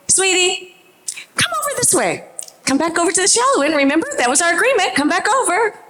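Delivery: put-on high, fast, urgent call to the child. Mother calling her son back to the shallow end, high and urgent.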